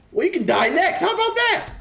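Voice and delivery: high put-on villain voice; mock villain threat. Streamer threatening Bill, high hammed-up villain gloat.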